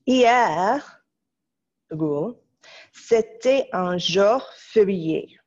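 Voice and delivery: "c'était un jour férié" read slowly, each word apart; slow dictation. Shown as a demonstration. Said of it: "phrase numéro six".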